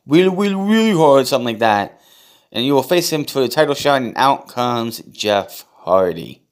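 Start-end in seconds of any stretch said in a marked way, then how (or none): 0.0-1.2 put-on high whiny baby voice; whiny man child. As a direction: whine it like a big baby. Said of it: "he doesn't like a big baby voice".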